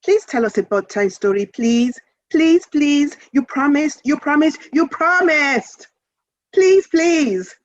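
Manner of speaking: put-on high, pleading child voice rising to a wail; begging child. A narrator's reading. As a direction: beg like a kid who won't give up. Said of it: "joined in".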